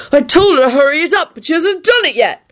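high, petulant complaint; aggravated. A narrator's reading. Neville complains she hasn't done it, aggravated. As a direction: keep grumbling high and exasperated. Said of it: "in an aggravated tone".

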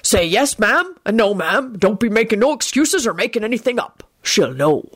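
lightly voiced gruff giant giving advice; Hagrid.

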